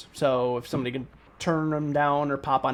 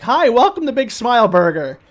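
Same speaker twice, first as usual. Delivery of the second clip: put-on teen cashier; 8 semitones higher, 10 dB louder than usual. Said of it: "he's like".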